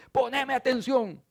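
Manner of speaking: high, loud exasperated shout; fed-up person yelling at someone who won't listen; angry outburst. Acted. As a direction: slam the table and demand attention. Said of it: "pega un manotazo en la mesa y dice".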